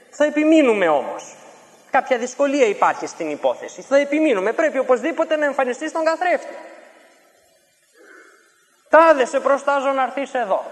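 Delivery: high, loud, stubborn command; insistent shout. Retold or imitated. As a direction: push harder against resistance. Sorcerer insisting, summoning harder. (ο μάγος επιμένει δυνατά)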